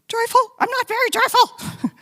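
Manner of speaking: a hammed-up voice of her desperate younger self; whiny complaint. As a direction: whine it like a miserable newcomer. Mocking her younger self's retort.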